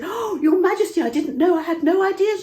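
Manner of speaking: high, loud desperate begging; panicked pleading. Acted. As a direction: beg for your life at her feet. Haman begs at queen's feet.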